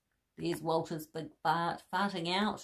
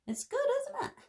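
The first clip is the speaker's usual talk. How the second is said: much higher, bright encouraging question; high delighted question; faster than usual. Real, not acted. Teller asks the child if they liked it, high and delighted.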